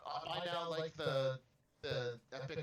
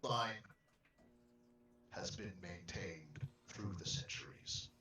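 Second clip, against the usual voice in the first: put-on grave, measured old believer; solemn in-character voice; 6 semitones lower than usual. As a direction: speak as a devout elder. In-character speech in the game.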